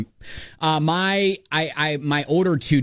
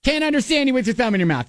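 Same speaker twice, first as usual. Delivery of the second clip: higher, faster exasperated nagging; exasperated repeating; 9 semitones higher, much faster than usual. Retold or imitated. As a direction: nag it again, rising frustration. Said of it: "And I'm like".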